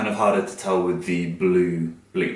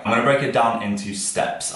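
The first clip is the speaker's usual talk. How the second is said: ordinary delivery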